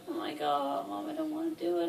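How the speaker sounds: high, fast whiny begging; whiny plea. Retold or imitated. To Mom on the way to surgery, whiny begging. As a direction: beg Mom like a scared kid.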